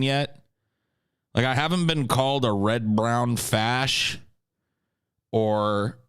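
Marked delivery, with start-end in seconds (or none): none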